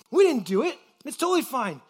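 breezy, sure of themselves; confident. Retold or imitated. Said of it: "Look at the confidence".

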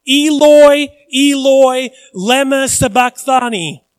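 ringing cry of abandonment; loud anguished cry. Acted. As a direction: cry it out from the cross. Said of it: "Jesus cried out with a loud voice".

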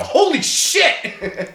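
high, drawn-out slow exclamation; mock astonished yell. Film fan acting out a first viewing, mock astonished yell. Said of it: "didn't out loud just yell".

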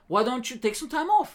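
high-pitched mock British accent; lightly put-on British advisor; British man. British guy suggesting time off, light mock British accent. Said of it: "British man".

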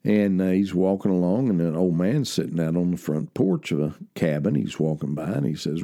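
ordinary delivery

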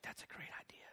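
put-on tiny whispered reply, as a child; mock-small voice, all whisper; whispered agreement. Crying daughter agreeing to dad's sink plan, whispered.

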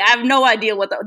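ordinary talk, nothing marked

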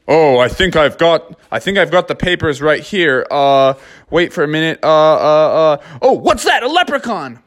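dad pretending to spot a leprechaun; father bear bluffing. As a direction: fumble for papers then point and gasp. Dad fakes papers, distracts guard.

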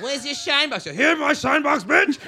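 loud, high mock shout through laughter; comic bellowing Diaz's punchline at the room. Acted Diaz riff.

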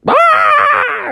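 long high panicked squawk; loud falsetto bird shriek; goose scream. Acted. Goose fleeing the giant, screaming.

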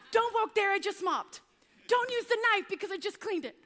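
mock-frantic mum guarding her clean house; fussy scolding mum. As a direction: shoo the kids off the mopped floor.

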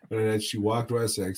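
ordinary delivery

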